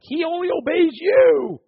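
high, slow, put-on voice of a complaining child; kid's whiny complaint. Kid complains that the dog only obeys dad, high and slow. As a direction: mimic the kid whining about the dog. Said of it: "my kid's like".

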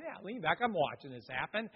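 quiet aside from the back; offhand remark. Retold or imitated. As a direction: toss it off softly, well out of earshot.

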